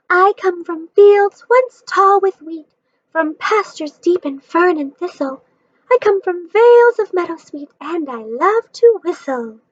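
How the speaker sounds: slow, high, sing-song recital; rhyming verse. Acted. Margalo telling Stuart where she comes from, sing-song verse. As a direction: recite it like a little poem. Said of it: "got a little rhyming in there".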